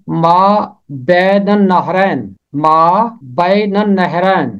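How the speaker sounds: ما بین النہرین slowly, النہرین as annahrain with doubled n; slow pronunciation demo. Shown as a demonstration. Teacher slowly models ما بین النہرین for learners. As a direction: carefully sound out ما بین النہرین. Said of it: "اس کا تلفظ کرنا آسان نہیں ہے".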